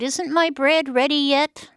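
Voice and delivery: put-on high, loud impatient call of an old woman; crying out. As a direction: call out to the kitchen, demanding. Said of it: "the old woman cried out".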